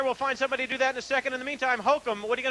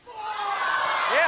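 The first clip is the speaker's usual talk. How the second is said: cheering yeah; 8 semitones higher than usual. Real, not acted. Host cheering with crowd.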